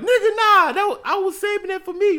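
high, loud acted-out objection; indignant protest. Boyfriend protesting his taken food, indignant. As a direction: voice jumps up in mock outrage.

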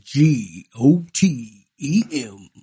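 slow letter-by-letter spelling; spelled out. Shown as a demonstration. Spelling the line out for friends, slow letters. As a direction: spell each letter slowly. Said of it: "spell it out".

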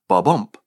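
"Ba-bump" with a light "Ba" and a punched "bump"; iamb stress. Shown as a demonstration. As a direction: say "Ba-bump" like a heartbeat, weight on the second syllable. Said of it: "the second syllable is stressed".

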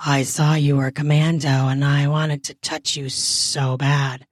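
low character voice; voiced male character. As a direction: drop well down in pitch for the man. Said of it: "one of them says".

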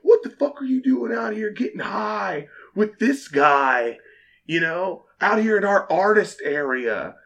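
retold rock star chewing out the manager; mock angry rocker. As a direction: imitate the musician yelling at you. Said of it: "He's like".

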